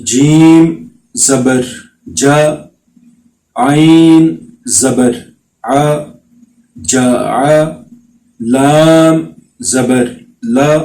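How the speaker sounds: slow, clear qaida spelling chant; measured teacher recitation; slow syllable drill. Shown as a demonstration. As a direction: spell each letter and vowel out for the children.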